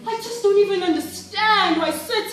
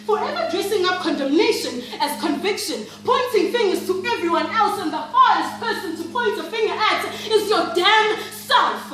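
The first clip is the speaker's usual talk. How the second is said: indignant rant; 4 semitones higher than usual. Acted. Acted rant against church hypocrisy.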